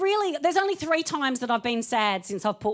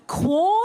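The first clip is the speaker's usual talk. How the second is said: Quorn? with a high rising question lilt; apologetic question; 5 semitones higher, 3 dB louder than usual. Shown as a demonstration. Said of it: "It's so apologetic".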